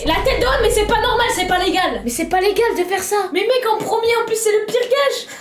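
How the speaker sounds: high, outraged wailing; indignant protest. Real, not acted. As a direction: plead your case in a high squeal. Argues against the unfair gage. (protestation indignée, voix suraiguë)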